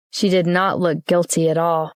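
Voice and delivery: plain reading voice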